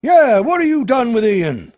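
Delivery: loud, high mob echo; jeering local. A narrator's reading. Another drinker joins in, loud and jeering. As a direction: pile on the accusation. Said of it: "said another".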